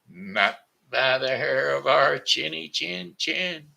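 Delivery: high, slow piggy voice; drawn-out little pig refusal; little pigs refusing. Acted.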